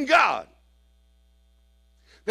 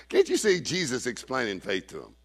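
ordinary delivery

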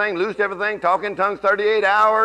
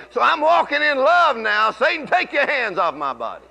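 bold declaration; 6 semitones higher than usual. Shown as a demonstration. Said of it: "you could say this".

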